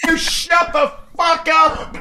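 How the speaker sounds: very high, slow shriek of rage; furious shout. Pretend. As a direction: scream it at the noisy podcasters. Angry passenger shouting at podcasters.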